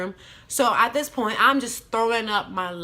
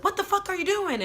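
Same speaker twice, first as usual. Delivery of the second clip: high, sharp retold yell at him; imitating the furious cousin bursting in; mock angry yell; 13 semitones higher than usual. Cousin walks in on the guy and yells at him in a high mock yell.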